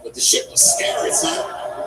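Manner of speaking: laughing through it, pitch jumping high; amused disbelief. Real, not acted. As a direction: laugh out the scary part. Laughing about being scared.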